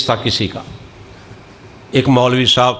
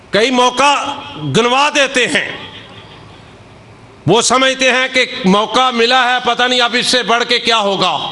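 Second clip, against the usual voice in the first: higher, raised sermon voice; fervent preaching; 9 semitones higher than usual. Acted. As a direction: drive the lesson home from the pulpit. Preaches at full force.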